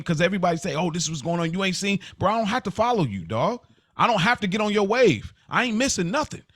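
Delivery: ordinary talk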